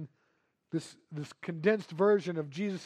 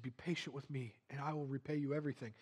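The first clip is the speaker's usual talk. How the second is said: pleading; 5 semitones lower, 12 dB quieter, faster than usual. Acted. Servant begging again.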